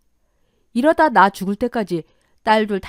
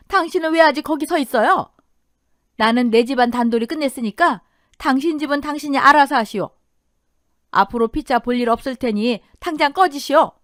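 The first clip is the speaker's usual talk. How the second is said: high, loud, sharp rebuke; indignant raised voice; angry dismissal; 4 semitones higher, 5 dB louder than usual. Acted. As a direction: send the bystander packing. Wife orders other man to leave.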